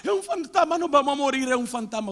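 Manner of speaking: high panicked shouts of fear; terrified shouting. Acted. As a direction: scream it like men who think they see a ghost. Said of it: "ellos estaban gritando". (gritos de pánico, agudos y fuertes)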